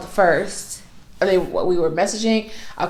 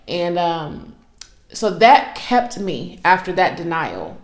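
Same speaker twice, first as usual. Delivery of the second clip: normal talking voice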